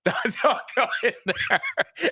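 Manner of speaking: a high laugh running through the words, then laughing it off. Real, not acted. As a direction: laugh through it as the story gets funny. Laughing while retelling a story.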